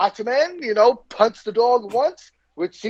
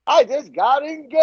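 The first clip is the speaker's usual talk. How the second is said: higher, excited blurt of good news; excited announcement; 8 semitones higher than usual. Retold or imitated. Stranger bursting into the diner, excitedly announcing his news. Guy bursts into Waffle House.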